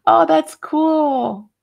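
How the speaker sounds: high, loud, long-drawn wow of approval; real delighted admiration. Real, not acted. Reacting to something cool on the stream, delighted. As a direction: stretch it out, really impressed.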